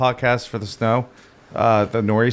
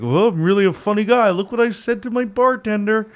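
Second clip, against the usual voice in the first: higher-pitched, self-pleased jokester; mocked joking customer; 8 semitones higher than usual. Bartender mocks customers who repeat a bad joke, smug imitation. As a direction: imitate someone pleased with their own stale joke. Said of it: "what do those people think".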